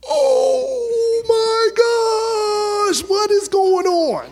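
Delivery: drawn-out, very high, loud amazed cry. Retold or imitated. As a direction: cry out in wonder at the kick. Father amazed at baby moving.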